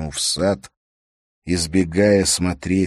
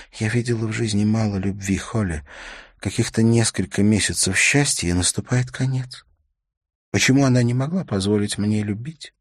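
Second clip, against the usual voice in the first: raised-pitch woman's voice, pleading; understated acted anguish; tearful widow; 5 semitones higher than usual. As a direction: lament it in a higher female character voice. Tearful widow laments lost love.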